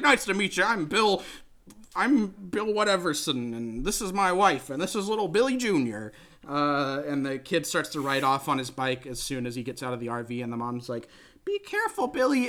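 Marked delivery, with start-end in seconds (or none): 0.0-6.1 higher, chipper goofy dad voice; goofy sitcom dad. Retold or imitated. Host imitating the goofy dad introducing his family, chipper and higher. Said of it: "he talks like this". 11.4-12.5 high shrill-free mom falsetto calling out; a quick falsetto mother warning; mock mom call. Host voicing the mom calling after her son, high falsetto.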